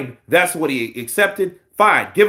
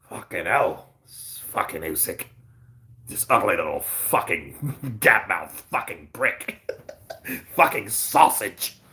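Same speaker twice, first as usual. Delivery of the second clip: much lower, softer grumbling rant; low muttered mock rival; mock Fury rant; 10 semitones lower, 7 dB quieter than usual. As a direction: growl it low under your breath like a sulking rival. Mocking Fury's voice.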